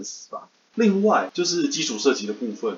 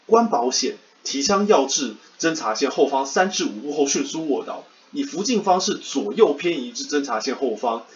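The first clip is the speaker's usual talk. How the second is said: rote recitation; 4 semitones higher than usual. Retold or imitated. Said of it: "一字不漏的背诵".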